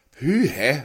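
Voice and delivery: et pust, lettet og forpustet; stønner lettet ud efter faldet; lidt stakåndet. A narrator's reading. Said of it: "fremstønnede han lidt stakåndet". (manden falder ud af karret, stakåndet)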